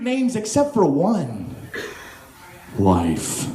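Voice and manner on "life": very low, subdued deadpan; deep, toneless announcement. Acted. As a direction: drop the word LIFE like a dud. Deadpan naming of the dull cereal.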